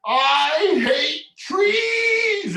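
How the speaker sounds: put-on high, drawn-out frustrated scream of a child; yelled in frustration. Storyteller voicing the boy's frustrated yell, very high. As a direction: let it burst out long and high. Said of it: "So he yelled".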